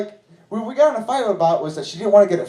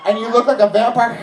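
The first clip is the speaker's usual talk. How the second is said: normal talking voice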